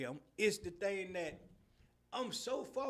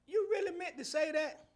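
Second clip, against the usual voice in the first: incredulous question; 8 semitones higher, 5 dB louder than usual. Retold or imitated. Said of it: "And I said".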